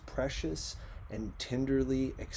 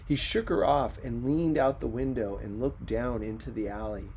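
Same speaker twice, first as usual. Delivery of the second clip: ordinary delivery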